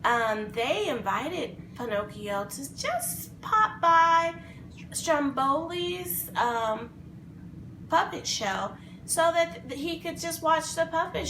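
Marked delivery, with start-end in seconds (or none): none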